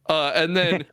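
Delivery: ordinary talk, nothing marked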